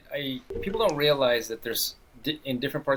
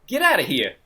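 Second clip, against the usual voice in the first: put-on brash American wise guy accent, raised pitch; 5 semitones higher, 7 dB louder than usual. Co-host quoting the line a film character aims for, wise guy accent. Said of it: "put on a wise guy accent".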